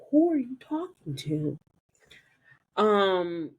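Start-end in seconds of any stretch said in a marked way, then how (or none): 0.1-1.6 higher, mock puzzled; puzzled question. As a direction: ask it as if startled by the phone. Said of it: "I'm like".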